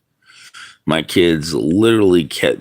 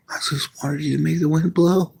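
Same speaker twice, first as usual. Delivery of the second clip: put-on high, fast, pleading little-boy voice; childlike plea; 10 semitones higher, 4 dB quieter, faster than usual. A boy begging God for wind, high and pleading. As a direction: beg like a small child asking for a miracle.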